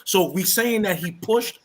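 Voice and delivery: ordinary talk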